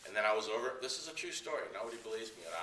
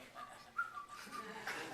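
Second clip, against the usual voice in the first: high squealing laugh; 6 dB quieter than usual. Retold or imitated. Fleeing as police pass.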